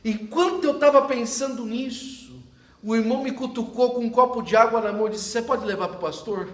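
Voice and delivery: preaching at full force. Acted. Pastor preaching to the congregation, loud and rising. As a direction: proclaim it to the whole church.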